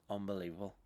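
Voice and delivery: ordinary delivery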